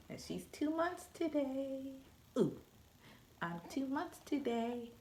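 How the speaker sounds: high, soft cooing. Real, not acted. Cooing baby talk to infant.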